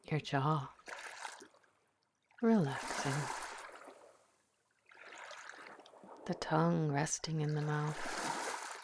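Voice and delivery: guided meditation. Acted. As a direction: ease the listener into calm, one breath at a time. Leads a guided visualization.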